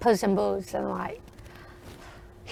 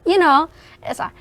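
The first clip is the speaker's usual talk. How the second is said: ordinary delivery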